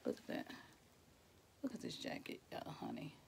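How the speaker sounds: hushed whisper; soft awed whisper. Real, not acted. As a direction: whisper it like a secret. Whispering over jacket.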